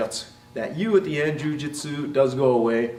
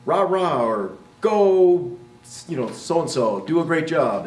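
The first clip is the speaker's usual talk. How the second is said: loud, high cheerleader "Go"; mock cheering; 4 semitones higher, 4 dB louder, slower than usual. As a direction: cheer them on like a fan. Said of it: "not that common for someone to say".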